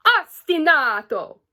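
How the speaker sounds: "Ostinato" in a silly, drawn-out Italian accent; fun Italian accent. Shown as a demonstration. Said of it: "I say ostinato in a fun, silly way".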